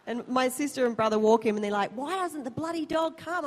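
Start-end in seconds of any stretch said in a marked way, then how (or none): 1.9-3.5 high-pitched mock complaint; mock exasperation. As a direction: imitate a baffled, exasperated dog walker. Imitates exasperated sister and brother.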